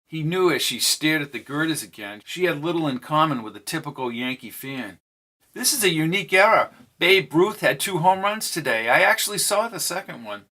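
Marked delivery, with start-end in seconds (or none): 5.5-10.4 higher, quick, perky young woman with a hint of British; bright-eyed mystery woman, eager about the game; Jamel's voice. A narrator's reading. Jamel telling Charlie about Babe Ruth, perky and higher.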